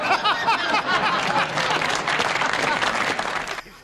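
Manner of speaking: silent wheezing laugh; breathless laugh. Real, not acted. Comedian laughing at his own Elawi punchline, wheezing.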